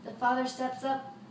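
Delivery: ordinary delivery, nothing marked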